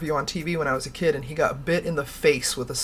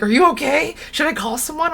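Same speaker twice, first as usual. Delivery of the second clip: much higher, anxious mock teacher voice; worried teacher; 9 semitones higher, 4 dB louder than usual. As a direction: play the startled teacher, fretting. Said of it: "and she's like".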